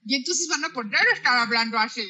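quick playful Indian accent in Spanish. Shown as a demonstration. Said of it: "van a tener un acento hindú perfecto".

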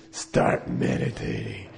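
very low, slow, voiceless croak of a lama; Darth Vader. Retold or imitated. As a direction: give the order deep and drawn out through a crushed voice box. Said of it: "he talked like Darth Vader".

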